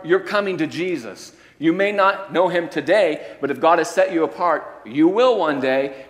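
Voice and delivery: loud, high preaching with punch; full-force preaching. Acted. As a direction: proclaim it to the back row.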